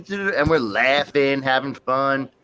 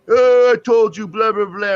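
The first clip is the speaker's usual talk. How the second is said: raised, higher-pitched cop scolding; mock angry cop; 6 semitones higher, 7 dB louder than usual. Cop to the boys as he writes a ticket, mock angry. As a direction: imitate an exasperated cop's loud telling-off.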